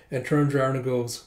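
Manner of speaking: ordinary delivery, nothing marked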